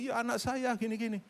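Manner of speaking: high, soft mock-tearful reply; imitated weeping. Crying mother answering the kind nurse, imitated tearful voice. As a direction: lightly imitate answering through tears. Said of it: "Ini orang nangis terus".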